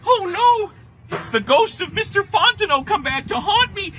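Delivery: high terrified outcry; panic, it's a ghost; panicked shout. Acted. Louis realizes ghost panic.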